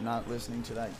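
ordinary talk, nothing marked

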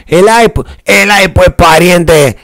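put-on lifted camba accent with "pariente" drawn out; mock Bolivian camba accent. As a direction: parody Bolivian lowland speech, high and sing-song. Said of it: "o diciendo".

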